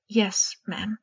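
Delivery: hushed, timid schoolboy reply; whispered reply. Acted. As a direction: breathe it, a shy boy answering his teacher. Student answers the professor.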